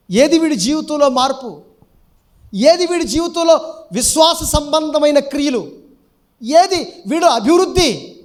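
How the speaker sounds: insistent, high-pitched rhetorical questioning; stern demand. Acted. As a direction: demand an account, pushing each question out. God demanding fruit in parable.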